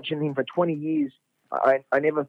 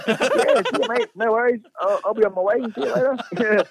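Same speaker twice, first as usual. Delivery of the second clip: high bright mock-cheerful farewell; overly chirpy goodbye; 9 semitones higher than usual. To the cop who just took his money, a high chirpy mock-cheerful goodbye. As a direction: cheerily pretend all is fine with the cop.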